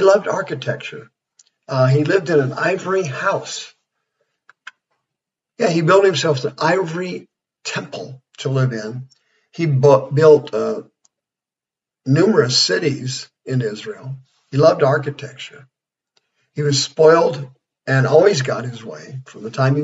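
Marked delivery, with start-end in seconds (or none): none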